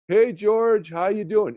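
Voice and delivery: imitating his dying father. Son imitating his dying father answering a friend's call, high and loud. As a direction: burst into a bright, high, loud greeting like a man revived. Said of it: "He would talk just like this".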